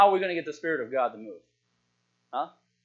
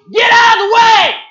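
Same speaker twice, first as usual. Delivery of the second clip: sudden high full-force yell; dramatic shout; 18 dB louder than usual. Acted. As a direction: bellow it as the big punchline. Dramatic answer after drum roll.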